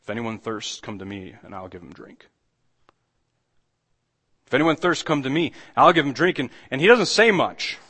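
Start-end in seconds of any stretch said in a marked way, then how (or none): 0.0-2.3 much softer, lower, inviting call; soft, hushed invitation. Retold or imitated. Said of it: "he stands up and he says". 4.5-6.4 louder, higher proclamation; proclaiming aloud. Retold or imitated. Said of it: "he stands up and he says".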